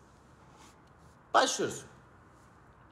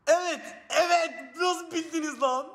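excited shout; 6 semitones higher than usual. Real, not acted. Delighted chat guessed right.

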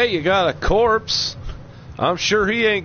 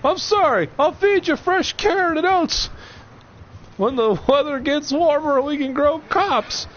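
frantic pleading shout; 14 semitones higher than usual. Pretend. Begging his horse not to jump.

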